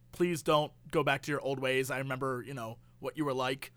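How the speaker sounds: low, soft movie-cop warning; weary cop plea. Acted. Cop warns Nick Cage off old ways, low soft plea. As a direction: plead quietly and low, as an acted old cop.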